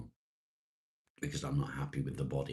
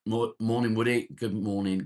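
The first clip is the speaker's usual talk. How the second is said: higher and louder, bright call across the room; cheery loud greeting; 7 semitones higher, 8 dB louder than usual. Real, not acted. Calling good morning to his mate Woody, loud and cheery. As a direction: sing out a hello to a mate.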